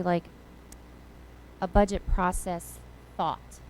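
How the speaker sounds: ordinary talk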